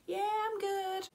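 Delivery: high, slow, sweet star voice. Retold or imitated. Star answers the fan in the booth, high impression. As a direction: put on a high pop-star lilt. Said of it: "And a bit more [name]-y".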